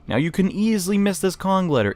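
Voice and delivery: normal talking voice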